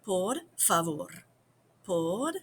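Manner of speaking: Por favor said slowly and carefully; slow, clear Por favor; slow teaching demo. Shown as a demonstration. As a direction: model the hard phrase for learners. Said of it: "This is kinda hard to say".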